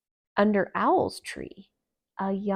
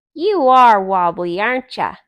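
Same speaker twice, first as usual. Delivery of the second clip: cheeky insisting; 13 dB louder than usual. Acted. Thumper repeats cheeky remark.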